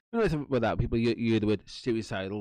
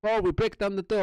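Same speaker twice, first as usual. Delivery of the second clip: higher, louder mock threat; police threatening to break in, higher and louder; threatening officer; 11 semitones higher, 5 dB louder than usual. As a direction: play the cop threatening the door.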